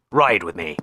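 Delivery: usual speaking voice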